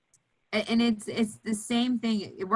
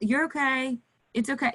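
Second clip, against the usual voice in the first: gentle reassurance; 5 semitones higher than usual. Shown as a demonstration. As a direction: soothe the child, lightly and kindly. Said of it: "You have to".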